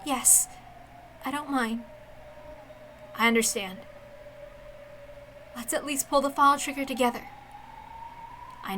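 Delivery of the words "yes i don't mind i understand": plain reading voice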